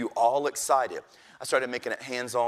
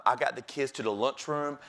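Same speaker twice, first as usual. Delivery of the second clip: normal talking voice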